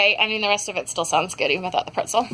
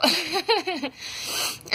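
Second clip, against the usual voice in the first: breathless laugh; 6 semitones higher, 4 dB quieter than usual. Real, not acted.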